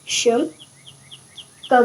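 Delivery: normal talking voice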